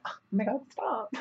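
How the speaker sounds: small, high, soft mock protest; coy high-pitched objection, barely pushed out; passive-aggressive. Telling off a handsy dance partner, passive-aggressive. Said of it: "so passive aggressive".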